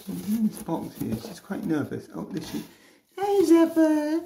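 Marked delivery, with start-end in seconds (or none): 3.2-4.2 high, slow cooing. Real, not acted. Cooing at ferret.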